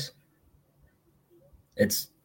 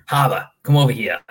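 mildly voiced angry Maine parent calling; a Maine mom calling her kid in, a hint of a yell; mock Maine accent; 3 semitones higher, 4 dB louder than usual. Parent calling kid Harbor, mild Maine accent. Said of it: "when you get mad at 'em".

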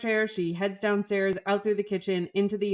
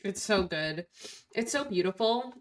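ordinary talk

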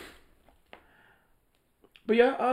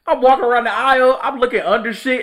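raised excited voice; loud excited retelling; 10 semitones higher, 10 dB louder than usual. Real, not acted. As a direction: tell it loud and worked up. Excited retelling, searching for customer.

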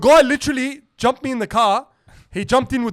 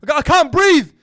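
mock protest shout; 6 semitones higher, 5 dB louder than usual. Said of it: "I'm like".

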